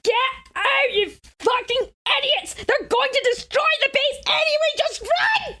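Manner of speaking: high-pitched, exasperated retold yell; hammed-up frantic shriek, climbing to the end; mock screaming at TV. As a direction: yell it as if at characters on screen. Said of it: "scream at the T V".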